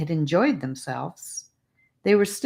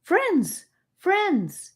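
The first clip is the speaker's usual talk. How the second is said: high call across a field; girl running across a field calling, high and eager. A narrator's reading. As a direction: call to them while running. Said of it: "she called".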